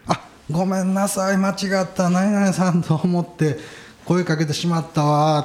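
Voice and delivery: high, drawn-out flustered apology; acted apologetic old woman. Old woman apologises for mistaking him for someone, acted high-pitched apology.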